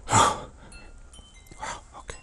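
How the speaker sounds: faint, airless whisper; barely audible murmur; voice gone to whisper. Real, not acted. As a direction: whisper it to yourself with no voice left. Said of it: "the voices disappearing again".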